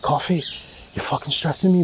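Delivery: a hint of a friend's accent, poorly done; Jack's accent. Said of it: "That's a horrible accent".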